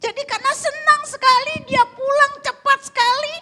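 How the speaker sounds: high, loud, breathless-with-joy narration; excited storytelling. Real, not acted. Preacher telling of the husband rushing home, high elated shouting.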